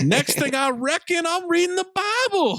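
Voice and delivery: very high exaggerated Southern twang; comic high-pitched Southern voice; mock Southern accent. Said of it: "decides to just do an accent".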